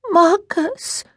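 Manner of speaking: put-on high, pleading child's voice; pitiful little-girl whimper of a name; pathetic little voice. A narrator's reading. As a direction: plead pitifully, rising at the end. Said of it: "in a voice so pathetic".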